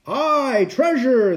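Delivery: high-pitched pirate cheer; crew shout. A narrator's reading. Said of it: "the others shouted".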